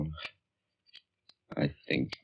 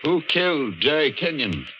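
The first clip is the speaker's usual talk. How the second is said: calling out; 9 semitones higher than usual. Acted. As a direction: shout up at the unseen ghost. Calling out to the ghost record.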